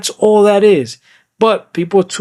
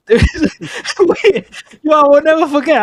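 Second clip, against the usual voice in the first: cracking up mid-story, pitch shooting up; laughing; 11 semitones higher, slower than usual. Real, not acted. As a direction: talk through real laughter, much higher. Cracks up while retelling story.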